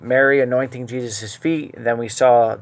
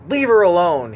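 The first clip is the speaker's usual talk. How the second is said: sharp, higher rebuke; 5 semitones higher, 4 dB louder than usual. Retold or imitated. Jesus telling Judas to stop, in a sharp tone. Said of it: "in a very sharp tone".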